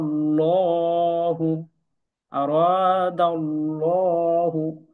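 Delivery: আরাদাল্লাহু with a full, dark rounded লা, drawn out slowly; heavy rounded lam. Shown as a demonstration. Teacher demonstrates thick lam to students, slow recitation. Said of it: "লা না বলে আমরা বলবো ল".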